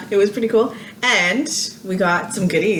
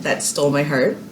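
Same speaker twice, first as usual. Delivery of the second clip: ordinary delivery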